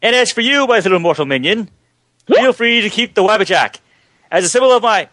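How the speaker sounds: high grand mad-god voice; Prince of Madness voice. Retold or imitated. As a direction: lord it over a mortal, pitched high. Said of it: "Or for one for Dave".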